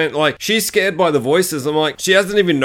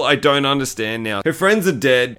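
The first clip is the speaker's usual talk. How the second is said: ordinary talk, nothing marked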